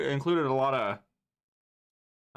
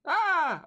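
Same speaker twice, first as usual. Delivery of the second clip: mock scream; 4 dB louder than usual. Jokey scream after jingle.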